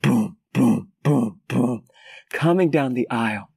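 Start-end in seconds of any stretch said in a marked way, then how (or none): 0.0-1.8 low, thudding boom boom; heavy footsteps. Retold or imitated. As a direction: stomp it out like running feet. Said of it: "louder rhythmic noise".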